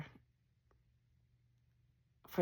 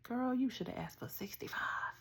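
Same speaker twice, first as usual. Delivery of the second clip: softer, whispered aside; 6 semitones higher, 5 dB quieter than usual. Retold or imitated. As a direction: whisper it like a secret between friends. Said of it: "and she was like".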